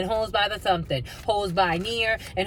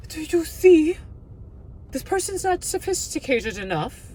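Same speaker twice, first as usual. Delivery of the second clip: posh British woman; 9 semitones higher than usual. Retold or imitated. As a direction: play the snooty British lady. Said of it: "sophisticated British woman".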